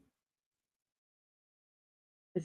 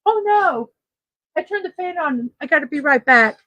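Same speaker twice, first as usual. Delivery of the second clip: sudden high "oh no" of real alarm; surprised; 6 semitones higher, 5 dB louder, faster than usual. Real, not acted. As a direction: caught off guard, voice jumps up. Fan blew list away.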